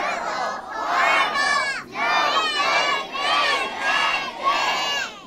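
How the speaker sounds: screamed refrain. Acted. Kids in the audience screaming the refrain along. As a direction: let the crowd shriek it out, slow.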